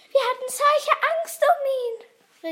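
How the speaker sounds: high, loud anxious exclamation; crying out. Acted. Mathilda recalls her fear for kidnapped Julebuck, crying out. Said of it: "rief Mathilda".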